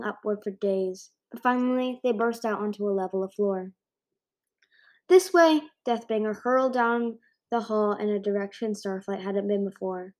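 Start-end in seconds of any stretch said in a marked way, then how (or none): 5.1-5.6 urgent shout. A narrator's reading. Leading escape.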